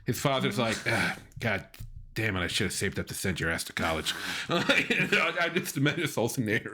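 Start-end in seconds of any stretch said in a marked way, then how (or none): 0.9-4.1 low, weary grumble; mock disappointed father. Father regretting the college money, mock disappointed. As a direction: grumble it low like a let-down dad.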